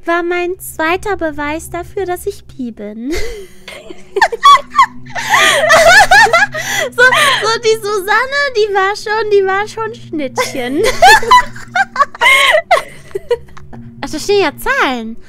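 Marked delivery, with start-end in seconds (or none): 3.2-6.9 high shrieking laughter. Real, not acted. Laughing fit. 6.9-10.8 high, loud giggly talk; laughing while talking. Real, not acted. Laughing through story. 10.9-14.0 high shrieking laughter. Real, not acted. Laughing fit.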